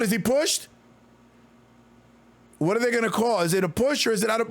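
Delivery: quick, lively game call; play-by-play call. Acted. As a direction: call the action as it happens. Live play-by-play calling.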